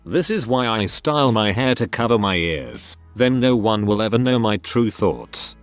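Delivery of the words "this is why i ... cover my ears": usual speaking voice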